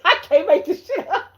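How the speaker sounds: high burst of laughter; cracking up, high squeal; laughing. Real, not acted.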